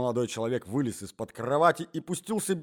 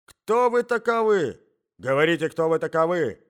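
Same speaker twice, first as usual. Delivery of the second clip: put-on loud, insistent old man; angry shouting; 4 semitones higher, 8 dB louder than usual. A narrator's reading. Old husband interrogating the hidden intruder, shouting. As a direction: demand it at full voice, drawing words out. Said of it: "кричал старик".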